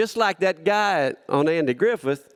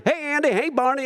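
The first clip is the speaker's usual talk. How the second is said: cheery greeting; 10 semitones higher, faster than usual. Retold or imitated. Greeting Andy and Barney.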